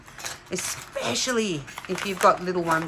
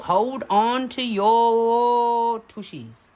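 higher, very slow build-up with a long held YOUR; drawn-out hype; 4 semitones higher, 4 dB louder, much slower than usual. Real, not acted. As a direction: stretch it out to brace the viewers. Hyping up a surprise find.

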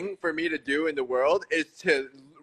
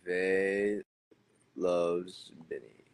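slow, low name searching; 10 semitones lower, 7 dB quieter, much slower than usual. Real, not acted. Repeating name while searching.

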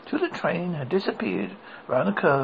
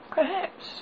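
put-on little girl voice. A narrator's reading. Dorothy suggests.

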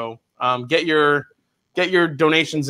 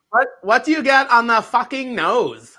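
put-on Valley Girl accent; 5 semitones higher than usual. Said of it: "reviews in a Valley Girl accent".

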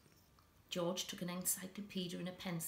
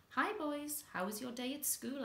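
bright, higher motherly hello; a mother welcoming her sons home from school, higher and bright; Mum's cheery greeting; 7 semitones higher than usual. Acted. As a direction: greet the boys like a cheery mum. Mum greets boys home.

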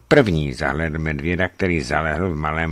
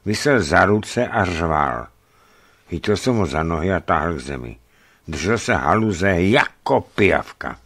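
usual speaking voice

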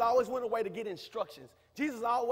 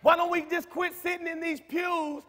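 preaching at full force, louder, rising to shouts; 6 semitones higher, 8 dB louder than usual. Acted. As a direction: rouse the congregation, building to a cry. Preacher exhorting congregation.

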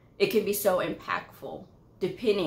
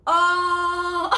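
high, loud, laughing oh; a caught-off-guard shout of delight; a loud, high, laughing "oh" of surprise; 11 semitones higher, 6 dB louder than usual. Retold or imitated. Boss finds his name in candy.

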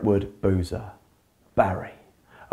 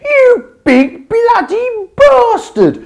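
much higher, louder, slow roar; furious yell; 8 dB louder, slower than usual. Acted. Barry screaming at the Blob as he starts boxing. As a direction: scream it with everything.

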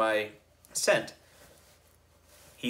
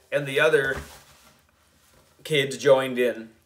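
ordinary delivery

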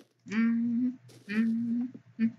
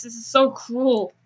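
ordinary talk

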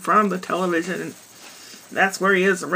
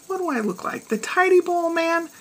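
lightly gruff, mocking retort; a hint of a grumpy husband snapping back; 9 semitones higher than usual. Husband mocking his wife's request in a light gruff voice. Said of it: "In a gruff voice".

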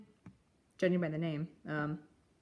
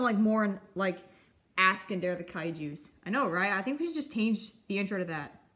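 usual speaking voice

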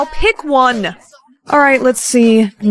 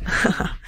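quiet mocking giggle; 4 semitones lower, 8 dB quieter than usual. Mocking laugh after prank.

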